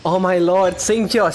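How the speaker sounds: normal talking voice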